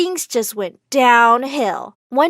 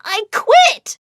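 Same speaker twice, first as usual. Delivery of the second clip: loud, high, slow cry of hurt; yelling; 11 semitones higher, 5 dB louder, much slower than usual. Acted. Chris yelling at the laughing crowd and cameras, loud and high. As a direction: scream it out, humiliated and fed up. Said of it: "I began to yell".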